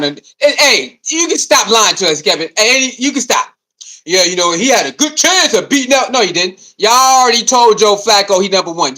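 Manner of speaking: normal talking voice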